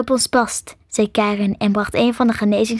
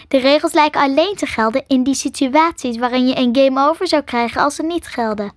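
plain reading voice

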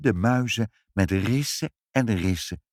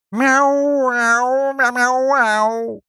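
high, loud yowling meows; shrill cat chorus; cats meowing; 7 dB louder, much slower than usual. Acted. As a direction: howl like a crowd of cats.

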